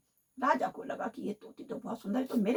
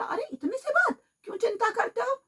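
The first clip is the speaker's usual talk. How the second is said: much higher cheery frog voice; frog cheering up; 10 semitones higher, 4 dB louder than usual. A narrator's reading. Frog cheering the crying parrot: high, breezy voice. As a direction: pipe up high and brush the worry off. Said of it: "बोला".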